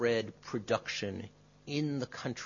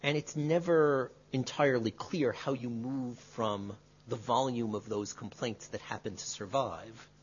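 ordinary talk, nothing marked

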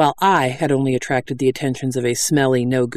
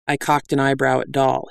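ordinary delivery

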